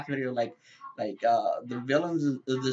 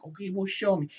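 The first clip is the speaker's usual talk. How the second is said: mock-belittling, higher-pitched challenge; 6 semitones higher than usual. Doubting listener challenges him to prove his powers, belittling. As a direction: talk down to him with a touch of disbelief. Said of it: "It sounds so damn belittling".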